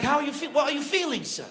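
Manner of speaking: high-pitched British reporter voice; British accent. Acted. BBC reporter interviews old shopkeeper, high British accent. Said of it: "how I got my British accent".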